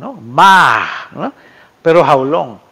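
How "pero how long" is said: usual speaking voice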